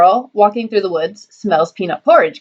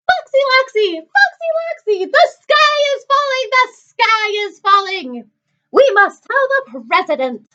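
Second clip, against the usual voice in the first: very high squawked alarm, easing down slightly at the end; yelling barnyard birds; 13 semitones higher than usual. Acted. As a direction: squawk it like frantic poultry. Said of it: "yelled Chicken Licken".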